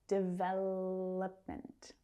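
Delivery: Development slowly, with a weak uh in the third syllable; slow de-VELLL-uhp-ment for Development; reduced schwa. Shown as a demonstration. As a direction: draw out VEL, reduce the o of Development to uh. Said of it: "third syllable reduces down to the schwa".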